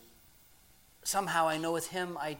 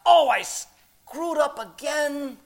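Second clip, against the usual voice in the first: high, loud, slow lament; drawn-out "I blew it" groan; dismayed self-blame; 9 semitones higher, 7 dB louder, slower than usual. Acted. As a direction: wail it lightly at your own mistake. Acts out his sick self-blaming thought.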